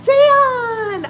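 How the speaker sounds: high, loud, sung-out name; excited calling out. Real, not acted. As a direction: greet him with real delight. Greeting a viewer by name.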